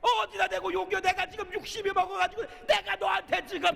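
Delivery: loud high furious scolding; angry scolding shout. Pretend. Wife shouting back at the husband who swears, furious. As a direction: yell at him, you've had enough.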